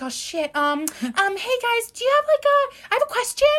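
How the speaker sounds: imitating herself as a scared kid asking for help; nervous little girl. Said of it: "I walk in like this little girl".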